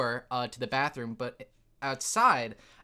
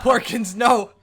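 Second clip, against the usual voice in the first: loud desperate cry; shouting no; 8 semitones higher, 12 dB louder than usual. Pretend. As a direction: yell the name in panic. Shouting a Star Wars line.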